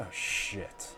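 unvoiced, soft curse; whispered oh shit. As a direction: whisper it in dismay. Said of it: "and I said".

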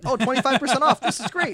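high, loud ad voice with a laugh; mock store ad. Store tweeting coupons, high mock ad chirp.

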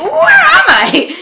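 very high, loud, drawn-out wail; comic panicked shriek, stretched slow; alarmed cry. Retold or imitated. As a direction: cry it out, lost and appalled. Acted alarm at finding herself lost.

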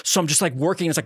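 ordinary talk, nothing marked

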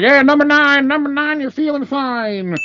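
high, rhyming order call; order caller. Retold or imitated. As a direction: holler the number, rhyming. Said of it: "hollering out the orders".

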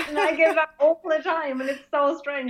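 ordinary talk

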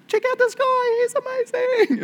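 very high-pitched mock hype; noble's gushing announcement. Highest noble showing off the man on horseback, gushing. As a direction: squeal it out like an over-the-top crier. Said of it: "the highest noble saying".

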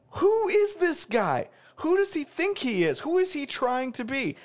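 high, slow disbelieving questions; can't believe what he's hearing; exasperated, drawn-out who-is-this; incredulous. Real, not acted. Mocking Kaepernick's new twang.

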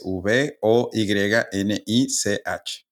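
letter by letter, deliberate; slow spelling. Shown as a demonstration. Host spells the collector's name for listeners, slow and clear. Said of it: "por qué lo estoy deletreando".

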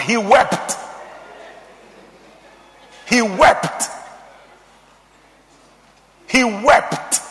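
wept punched with a firm beat; emphatic, spaced repeats of wept; stress on wept. Real, not acted. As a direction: land hard on "wept", pausing between repeats. Preacher repeats contrasted word for emphasis.

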